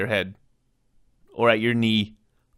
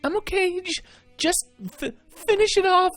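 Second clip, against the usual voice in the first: wounded soldier gasping. Acted. Wounded friend urges on.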